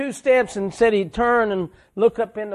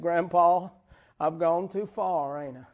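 usual speaking voice

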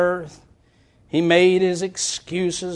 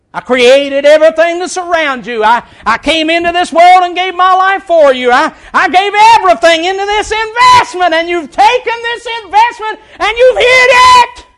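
loud, high rebuke; preaching at full force; 9 semitones higher, 11 dB louder than usual. Acted. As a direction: hammer each line at them. God rebuking servant, preaching force.

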